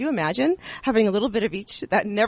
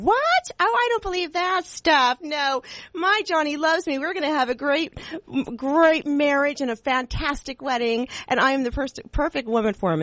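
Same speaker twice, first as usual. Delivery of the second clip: high, gushing, over-the-moon bride voice; 7 semitones higher than usual. Retold or imitated. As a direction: play a giddy bride bragging about her wedding. Mock bride denying Mercury warning.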